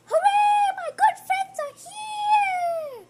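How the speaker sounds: very high, loud joyful shout; cheering Amos. Acted. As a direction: cheer as friends arrive at the door. Amos cheers seeing friends.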